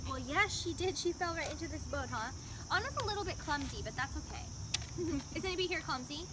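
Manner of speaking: put-on soft, gentle princess voice talking to children; storytelling queen speaking softly to kids; Elsa character voice. Plays Elsa at a kids' party.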